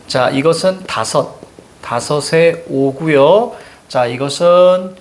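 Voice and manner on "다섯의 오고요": ordinary talk, nothing marked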